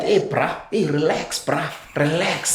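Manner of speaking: lightly voiced paramedic, soothing; paramedic calming him. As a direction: talk him down like a medic. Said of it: "they call out".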